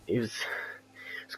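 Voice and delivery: breathy whispered aside. Real, not acted. Aside about an odd year.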